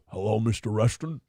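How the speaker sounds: low, smooth Nixon greeting. Retold or imitated. Nixon voiced in a retold scene.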